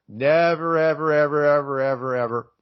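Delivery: loud, stretched NEVER and ever, fading away. Real, not acted. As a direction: hammer each "ever" then let it trail off. Emphatic repeated never.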